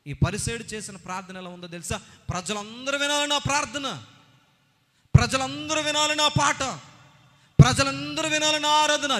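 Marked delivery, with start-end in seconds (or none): 2.3-9.2 loud, high, proud mock boast; boastful Pharisee. Preacher mocking the Pharisee's showy prayer, loud mock boast.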